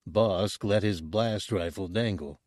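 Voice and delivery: ordinary delivery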